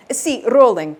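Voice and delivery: trilled R on rolling, Italian engineer; Italian accent. Acted. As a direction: roll the R like an Italian sound engineer. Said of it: "He trilled the R again".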